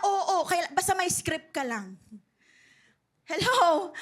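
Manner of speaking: ordinary talk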